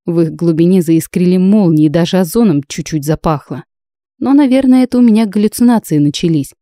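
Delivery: plain reading voice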